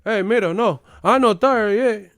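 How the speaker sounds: put-on loud, high partying Cuban accent. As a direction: shout it like a clubber with a Cuban accent. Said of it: "we'll be at the club listening to bachata".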